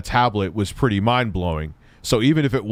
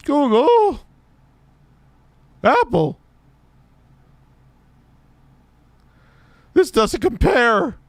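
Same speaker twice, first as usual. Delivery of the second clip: very high, whiny parody; mock the speaker in falsetto; squeaky mocking voice; 4 dB louder than usual. Mocking John's pitch.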